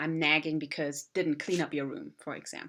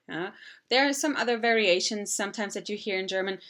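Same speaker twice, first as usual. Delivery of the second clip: ordinary talk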